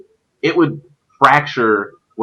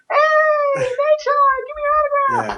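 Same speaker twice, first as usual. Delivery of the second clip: very high, squeaky mock kid voice; excited kid fan. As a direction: imitate a little fan squealing for attention. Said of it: "It's like kids jumping on you".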